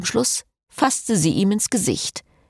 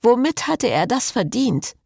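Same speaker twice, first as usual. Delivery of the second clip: plain reading voice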